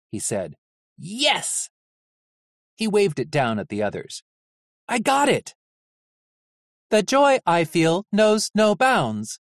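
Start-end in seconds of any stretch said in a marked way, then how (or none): 1.0-1.7 excited yes. Acted. Vic celebrates find. 4.9-5.6 high, triumphant call; excited shout. Acted. Vic calling down to the others, high and triumphant. 6.9-9.4 high, slow dry robot sarcasm; mock joy, utterly flat; deadpan sarcasm. Robot nurse mocking Vic's joy, slow high sarcasm.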